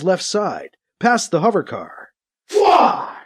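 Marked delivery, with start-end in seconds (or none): 2.5-3.2 raised, whooshing energy-gun shot; rifle blast sound. A narrator's reading. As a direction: imitate a laser blast with the mouth. Narrator voices rifle blast sound effect.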